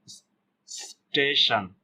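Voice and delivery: a long bare "sss" leading into स्टेशन; drawn-out half S. Shown as a demonstration. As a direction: stretch the s, then say स्टेशन slowly. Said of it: "आधा स का".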